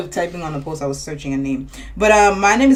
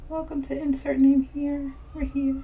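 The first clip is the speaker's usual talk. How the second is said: higher announcer-style podcast welcome; mock broadcast intro; show intro; 4 semitones higher, 9 dB quieter than usual. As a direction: open the show like hosts.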